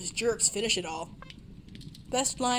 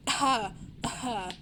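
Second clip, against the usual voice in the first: normal talking voice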